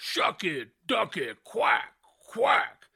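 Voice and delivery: slow, drawn-out catchphrase; Booker T impression. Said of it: "Like he goes".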